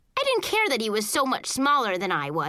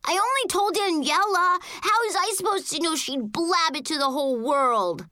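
put-on protesting little kid; 6 semitones higher than usual. A narrator's reading. Kevin defends himself in quarrel.

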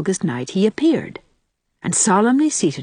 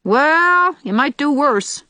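high, protesting country suitor; expostulating; 8 semitones higher, 3 dB louder, slower than usual. A narrator's reading. Sam argues with Anne's refusal, expostulating. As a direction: push back, pitched right up. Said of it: "expostulated Sam".

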